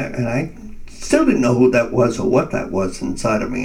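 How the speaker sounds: usual speaking voice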